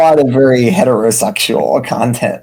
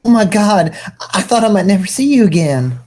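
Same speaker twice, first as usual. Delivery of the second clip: higher gushing voice, rushing at the end; 6 semitones higher, faster than usual. Acted. The possessed prophet gushing to old friends. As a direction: pour out joy in a higher voice.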